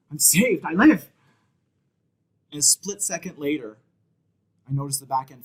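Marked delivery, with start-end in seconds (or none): 0.1-1.1 louder, higher burst of relief; acted relief. Driver thinking the semi has missed him, exclaimed relief. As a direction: exclaim it like a sudden mock-triumphant reprieve. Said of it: "never felt so much relief".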